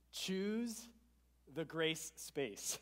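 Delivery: slow, drawn-out catchphrase; slogan delivery. Shown as a demonstration. Pastor challenging the church with his slogan, slowly. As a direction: stretch out grace space. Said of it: "I have a challenge for you".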